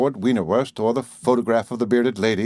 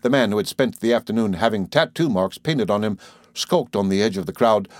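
ordinary delivery, nothing marked

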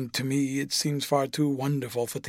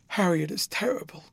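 breathless whispered cry, a little higher; anguished whisper; 4 semitones higher than usual. A narrator's reading. Dorian cries out to Harry over Sybil's death, whispered anguish. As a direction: whisper it out in shock and grief. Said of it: "cried the lad".

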